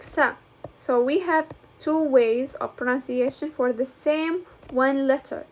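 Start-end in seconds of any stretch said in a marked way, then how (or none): 0.0-0.3 "sa" as a hissed S with short vowel; soft C sound. Shown as a demonstration. Teacher showing the other way to pronounce C, soft C sound. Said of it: "I could pronounce it like".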